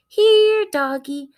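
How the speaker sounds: high, loud sing-song coaxing; lightly voiced, lure the dog over; coaxing burglar. Burglar coaxing the dog with soup, a lightly voiced sing-song.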